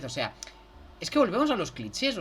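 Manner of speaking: ordinary talk, nothing marked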